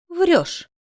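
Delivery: high, indignant accusation; sudden high-voiced disbelief; indignant cry. A narrator's reading. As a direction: throw it at him, pitched high. Heroine accuses him in argument.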